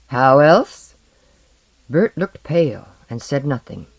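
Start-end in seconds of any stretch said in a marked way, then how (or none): 0.1-0.9 proud sneer, louder; loud scornful retort. Acted. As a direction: toss it back, loud and smug. Blackbeard retorts.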